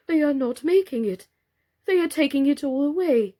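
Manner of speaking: high, loud worried cry; alarmed doll. A narrator's reading. A doll crying out as the house is stripped, high and loud. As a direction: protest in rising alarm.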